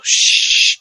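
long, drawn-out shh; a conspiratorial, drawn-out hush; playful shushing. Pretend. Hushing about a sensitive subject.

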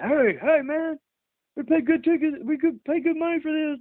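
high mock complaint from the seats; imitating the fans behind them complaining; annoyed fans. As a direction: whine like an angry fan behind you. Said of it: "those guys finally just like".

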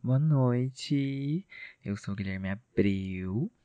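soft, seductive radio voice; sultry narrator. Acted. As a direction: purr it like a late-night host. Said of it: "Ai, tá sexy".

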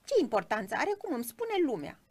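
high, fast, loud little creature; squeaky, slightly hoarse voice. A narrator's reading. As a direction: snap back squeakily as a tiny cricket. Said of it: "vocea pițigăiată și cam răgușită". (voce pițigăiată și cam răgușită)

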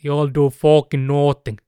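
loud, slowed mock shout; imitated yell. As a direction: belt it out like a furious fighter. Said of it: "se huusi". (matkittu huuto, kovaa ja hitaasti)

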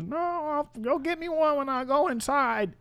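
put-on high, drawn-out old-lady voice. Old lady refusing the offer.

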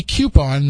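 "coupon" opening with cue, KYOO-pon. Shown as a demonstration. Host arguing from spelling, "coupon" as KYOO-pon. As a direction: put a y-glide in "coupon". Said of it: "it would have to be C U".

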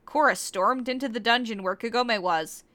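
usual speaking voice

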